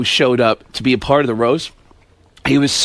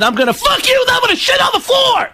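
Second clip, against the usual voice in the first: screaming comic, climbing very high; Sam Kinison. Acted. Comic as Kinison recalling his night, escalating scream. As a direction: build to a raw shrieking punchline. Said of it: "[name] is Sam Kinison".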